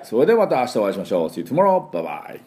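normal talking voice

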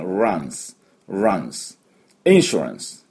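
"runs" as "rance" with a clear R, falling; English R. Shown as a demonstration. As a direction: model "runs", then join it into "insurance". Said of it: "Rですから".

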